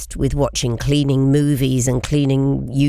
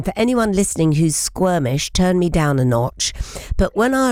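ordinary talk, nothing marked

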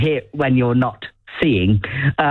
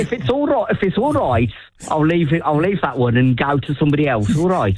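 Brummie impression; 2 semitones higher than usual. Replying as a Brummie boss, in a Brummie accent. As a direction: slip into a Brummie lilt. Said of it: "so you can speak like that".

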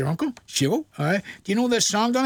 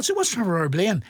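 ordinary talk, nothing marked

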